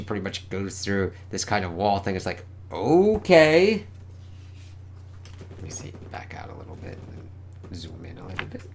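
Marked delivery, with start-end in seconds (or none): 2.7-3.9 high, loud, drawn-out word; acted wide-eyed reaction; bewildered okay. Reacting to going through a wall. 5.6-8.6 very soft, near-whispered aside; barely voiced murmur; muttering to self. Real, not acted. Muttering while adjusting camera zoom.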